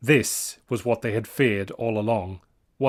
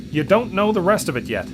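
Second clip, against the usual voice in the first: eager; 7 semitones higher, 4 dB louder than usual. A narrator's reading. Scott continues to Brains.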